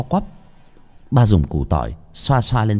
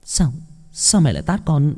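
stammering tearfully; 6 semitones higher than usual. A narrator's reading. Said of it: "ấp úng".

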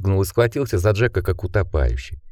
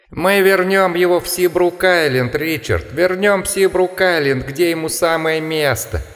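much higher, howling mockery; howling taunt; 8 semitones higher than usual. A narrator's reading. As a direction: taunt like a laughing creature outside the window. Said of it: "смеющийся и подвывающий".

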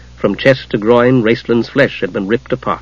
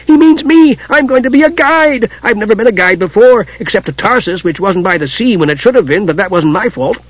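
excited; 8 semitones higher than usual. Acted. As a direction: gush it out thrilled. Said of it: "cried Tas in excitement".

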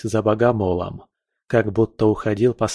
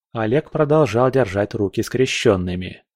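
ordinary delivery

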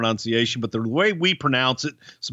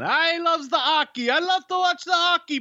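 put-on much higher French-Canadian accent, no H in "hockey"; Pat Patterson impression. Co-host imitating Pat Patterson talking about hockey, French-Canadian accent. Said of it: "as Pat Patterson would say".

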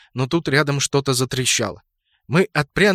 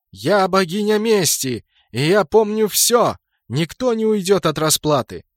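vengeful goddess; 7 semitones higher, 4 dB louder than usual. A narrator's reading. Divana answers the titan as goddess of vengeance, high and grandiose. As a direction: declare vengeance with ringing grandeur.

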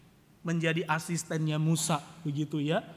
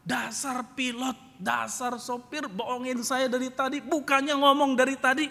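high-pitched cursing, dragged out then louder; angry grumbling; 10 semitones higher, 6 dB louder than usual. Retold or imitated. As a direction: grumble indignantly, building to a loud complaint. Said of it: "berdiri sambil marah-marah". (mengomel sambil marah-marah)

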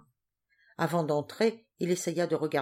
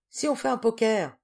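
put-on young man's offer; 6 semitones higher, 5 dB louder than usual. A narrator's reading. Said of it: "proposa un jeune homme".

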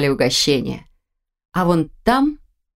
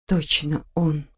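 hushed, slow, scared whisper; frightened whisper; 2 semitones lower, 6 dB quieter, slower than usual. Acted. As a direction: breathe it out in alarm, slowly. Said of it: "испуганно прошептала девушка".

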